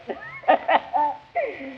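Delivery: high chuckle trailing down; hearty laugh. Acted. Comedian laughing at the tar on the bride's dress. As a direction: laugh it off, falling away.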